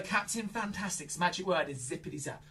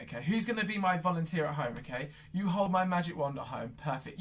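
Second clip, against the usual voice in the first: usual speaking voice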